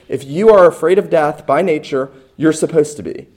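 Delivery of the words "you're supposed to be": usual speaking voice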